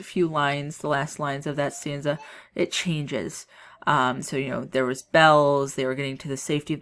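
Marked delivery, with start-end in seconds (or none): none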